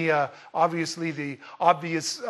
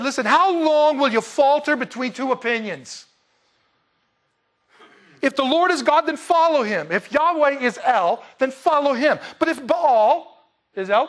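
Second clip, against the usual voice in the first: forceful, higher-pitched prophetic challenge; preaching; 6 semitones higher, 3 dB louder than usual. Acted. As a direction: drive each choice home like a preacher confronting the crowd. Preacher declaims Elijah's challenge.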